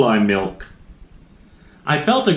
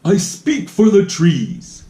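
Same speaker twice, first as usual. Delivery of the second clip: louder, scolding creature voice; sharp little guardian telling him off; bossy Lorax; 5 semitones higher, 4 dB louder than usual. A narrator's reading. Lorax scolding the greedy Once-ler, sharp and bossy. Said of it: "cried the Lorax".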